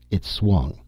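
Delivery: ordinary delivery, nothing marked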